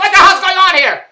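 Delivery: loud, high complaint; calling out. Retold or imitated. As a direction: yell it down to the front desk. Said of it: "I called out at the front desk".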